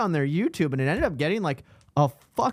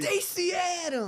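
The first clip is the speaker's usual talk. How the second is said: drawn-out high reveal; 5 dB quieter, slower than usual. Acted. Dramatic reveal of shoe brand.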